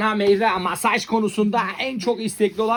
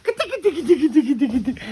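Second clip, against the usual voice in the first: high playful tickle chant; high playful chant while tickling; tickling sing-song; 7 semitones higher than usual. Pretend.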